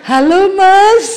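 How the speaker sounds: slow, high, sugary "hello"; wife picking up her husband's phone at one a.m., syrupy sweet. Retold or imitated. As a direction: draw it out high and sweet, like a trap. Wife answers late-night call.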